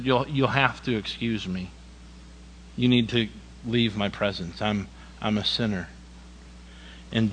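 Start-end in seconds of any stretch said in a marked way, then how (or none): 0.0-5.9 hushed Peter. Acted. Peter voiced softly.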